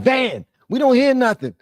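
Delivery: very high, loud mock heckling; high-pitched pestering voice; complaining chat viewers. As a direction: squeal at the streamer as an annoyed audience. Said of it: "y'all like".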